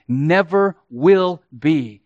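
ordinary talk, nothing marked

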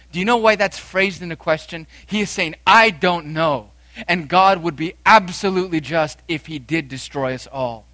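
a preacher driving home how the Ninevites repented; full-force preaching. Acted. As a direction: thunder out true repentance.

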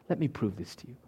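normal talking voice

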